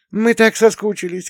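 elder daughter shouting to her dad; excited child shout. A narrator's reading. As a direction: shout it out like a thrilled kid. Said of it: "кричала старшенькая".

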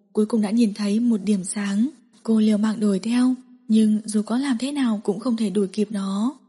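ordinary delivery, nothing marked

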